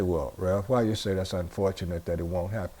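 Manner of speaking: imitating a listener's doubting question. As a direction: drop low and quiet to voice the questioner.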